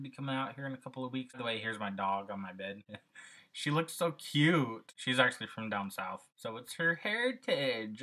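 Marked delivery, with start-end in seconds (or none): 3.5-4.9 cooing over the dog. Real, not acted. Vlogger cooing at his dog lying on his bed, slow doting voice. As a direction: gush over how cute the dog is, slowly.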